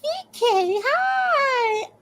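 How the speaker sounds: very high, sung-out hello; high-pitched, drawn-out excited greeting; delighted greeting. Real, not acted. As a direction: squeal hello to a friend. Greeting a viewer joining the stream.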